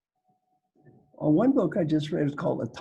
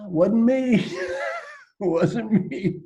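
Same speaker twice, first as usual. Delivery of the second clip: mock denial; 8 semitones higher, 4 dB louder, much slower than usual. Joking denial of past mistakes.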